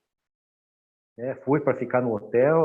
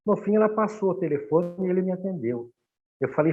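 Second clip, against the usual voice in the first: normal talking voice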